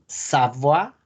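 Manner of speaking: Savoie as sabwa; French pronunciation. Shown as a demonstration. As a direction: model the French oi as wa. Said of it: "Saboya es". (Savoie pronunciado sabua)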